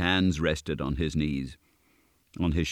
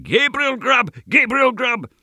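very high, loud shriek; wild screaming chorus; 8 dB louder than usual. A narrator's reading. Invisible voices taunt Gabriel, high wild screaming. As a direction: scream it like a wild goblin mob. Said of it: "Again the mysterious voices replied".

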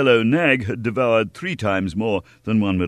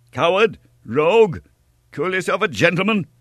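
high, loud insults hurled; furious cry; 11 semitones higher, 5 dB louder than usual. A narrator's reading. Young hero to the fleeing stranger, furious shouting. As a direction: shout abuse after them. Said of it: "cried D'Artagnan".

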